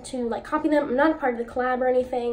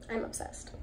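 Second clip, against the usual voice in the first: whispered; 13 dB quieter than usual. Real, not acted. Awed whisper at swatch.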